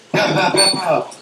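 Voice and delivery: quick mock rifle shots; imitated gunshots. Hunter imitating the locals shooting.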